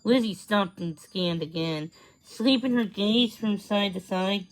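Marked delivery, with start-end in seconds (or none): none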